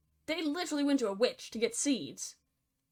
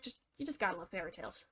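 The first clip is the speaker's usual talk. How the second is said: fast, much softer sarcastic aside; muttered sarcasm; 5 semitones lower, 11 dB quieter, much faster than usual. Acted. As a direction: mutter it under your breath, dry.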